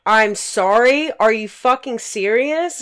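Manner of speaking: loud, slow, questioning disbelief; indignant, stretched-out challenge; retold incredulous anger. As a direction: throw the apology back as an outraged question. Throws the apology back, incredulous.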